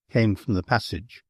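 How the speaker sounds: plain reading voice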